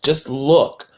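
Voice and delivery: "look" leaned on firmly; stress on look. Shown as a demonstration. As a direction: press hard on "look". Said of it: "and I stress the word look at".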